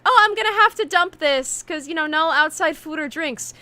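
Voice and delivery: imitating the guard dumping the water; security guard. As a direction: put on the guard's rule-quoting act. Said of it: "and she's like".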